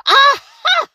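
high loud eureka shout; shouted aha. Acted. The witch getting an idea, shouting with a jump. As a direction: burst out with the idea. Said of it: "she shouted with a jump".

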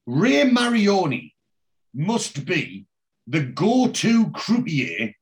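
slow, portioned-out big claim; dramatic build-up. Real, not acted. Host presenting croupier trivia, dramatic build-up.

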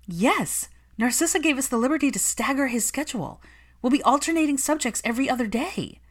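high, bright giddy nanny voice; giddy excitement. A narrator's reading. Nanny giddily tells Hermione about the new schedule, excited. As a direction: bubble over with news. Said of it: "Catherine was giddy with excitement".

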